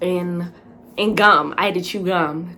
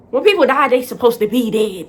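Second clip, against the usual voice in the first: loud, raised-pitch objection; incredulous protest; 4 semitones higher, 7 dB louder than usual. Retold or imitated. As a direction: argue it back in disbelief. Argues against being told she died.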